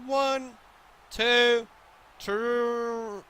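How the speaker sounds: loud, high wrestling count; excited pin count. Real, not acted. Streamer counting along with a wrestling pinfall, excited.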